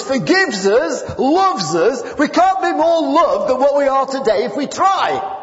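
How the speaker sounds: high, loud, slow preaching proclamation; preacher proclaiming God's love at the climax; preaching at full force. Acted. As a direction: drive the message home to the congregation.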